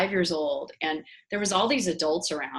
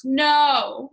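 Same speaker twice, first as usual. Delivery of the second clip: mildly mimicked kid's loud, higher no; imitated child's refusal; 7 semitones higher, 7 dB louder than usual. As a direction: refuse like a stubborn little boy, retold. Voices boy refusing the teacher.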